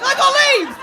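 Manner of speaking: hammed-up loud cry; mock shout. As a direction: bellow it like a proud tree. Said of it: "And I'm like".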